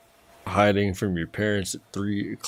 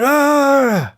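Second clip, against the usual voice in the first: startled scream; 6 dB louder than usual. Retold or imitated.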